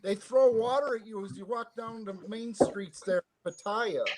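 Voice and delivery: speaking from far away down a shaft; down a well. Real, not acted. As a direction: still distant and faint. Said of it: "No need to yell, mate".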